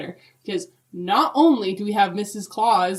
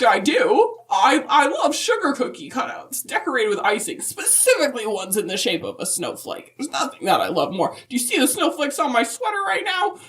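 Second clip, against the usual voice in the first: put-on higher old lady voice with laughter; a delighted grandma gushing about cookies; giggly Mrs Claus; 6 semitones higher than usual. Telling the host her favourite cookie as Mrs Claus, higher and giggly.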